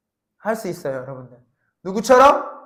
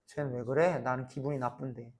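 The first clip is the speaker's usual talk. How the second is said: grumpy mutter; 8 semitones lower, 14 dB quieter than usual. Pretend. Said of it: "이래?".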